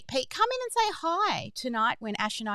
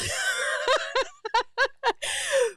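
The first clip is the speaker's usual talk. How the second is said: high laugh. Real, not acted. Laughing after butt crack story.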